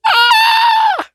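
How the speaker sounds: sudden very high scream of frustration; frustrated scream. Real, not acted. Woman failing a tongue twister repeatedly, frustrated scream. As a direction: let out a piercing squeal at your own mistake.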